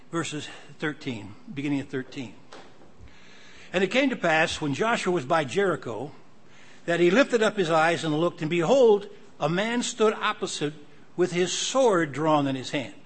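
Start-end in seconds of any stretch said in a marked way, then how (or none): 3.7-12.9 louder, projected reading of the verse; preacher reading out the Bible; emphatic scripture reading. Acted. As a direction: proclaim it, leaning hard on key words. Reads scripture aloud.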